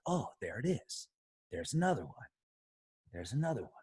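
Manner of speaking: hushed, spotting things one by one; quiet discovery. Pretend. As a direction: murmur as if finding hidden keys. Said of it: "We're like".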